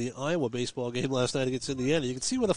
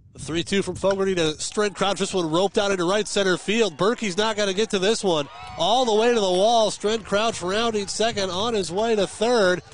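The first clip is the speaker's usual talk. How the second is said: loud, high call of a big hit; excited play-by-play; 5 semitones higher, 5 dB louder than usual. Acted. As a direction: bring the crowd up with the hit. Calls a triple to the wall.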